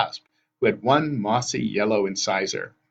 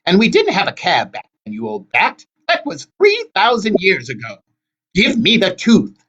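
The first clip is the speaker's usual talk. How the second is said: put-on high, loud squabbling old sister; quarrelling hag; 10 semitones higher, 7 dB louder than usual. A narrator's reading. Wasp quarrels with her sister.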